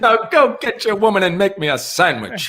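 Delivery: put-on high, loud old-timey chauvinist; cold belittling sneer. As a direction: sneer it like a 1920s bully. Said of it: "the nineteen twenties guy".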